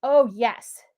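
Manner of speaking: usual speaking voice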